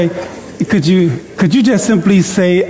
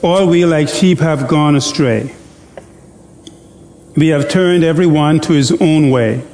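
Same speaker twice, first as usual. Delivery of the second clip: deliberate pulpit reading of scripture; solemn scripture reading. Acted.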